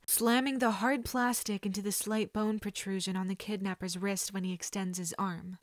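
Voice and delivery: ordinary delivery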